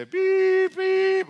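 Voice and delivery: high, long, loud mock alarm beeps; imitated alert beeps. Pastor mimics the emergency weather alert on phones, high loud beeps.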